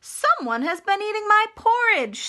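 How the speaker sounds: high, loud bear voice with a different timbre; Papa Bear. Acted. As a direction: boom it out as the upset papa bear. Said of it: "said Papa Bear".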